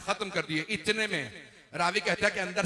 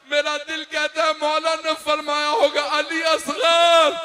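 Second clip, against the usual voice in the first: building from steady recitation to a long drawn-out wailing cry, much higher and louder; impassioned majlis preaching; 9 semitones higher, 10 dB louder than usual. Acted. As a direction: preach it up until the last words are stretched into a lament.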